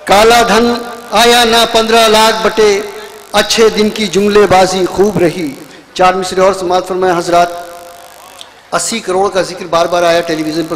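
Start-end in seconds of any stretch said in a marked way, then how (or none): 0.1-5.5 measured, rhythmic Urdu verse delivery; poetry recitation. Acted. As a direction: recite it like a mushaira poet. Poet recites verse at mushaira.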